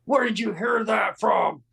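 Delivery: high, drawn-out mock demand; indignant neighbor. Neighbor challenges him about moving, indignant.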